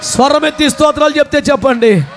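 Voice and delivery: loud rallying call to praise; preaching shout. Real, not acted. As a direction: lift the crowd with a big shout. (గట్టిగా పిలుపు)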